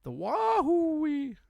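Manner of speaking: high, slow cartoon yelp; Goofy yell. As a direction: do Goofy falling off a cliff. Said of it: "the Disney Goofy".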